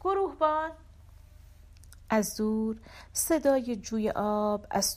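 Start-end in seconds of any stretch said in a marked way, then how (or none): 0.0-0.7 high, restrained cry of a name. A narrator's reading. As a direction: call out high into the distance. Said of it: "فریاد زدم". (فریاد زدن با صدای زیر)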